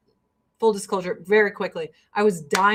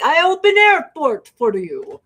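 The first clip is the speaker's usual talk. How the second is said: louder, higher hammed-up Russian accent; 6 semitones higher, 6 dB louder than usual. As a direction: boom it out like a proud Russian mayor. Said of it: "the mayor of Sochi was like".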